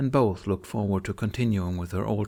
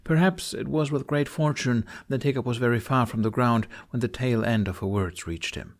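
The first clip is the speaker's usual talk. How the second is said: ordinary delivery, nothing marked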